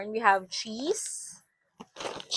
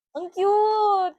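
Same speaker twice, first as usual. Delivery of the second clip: excited; 9 semitones higher than usual. Real, not acted. Delighted by body wash.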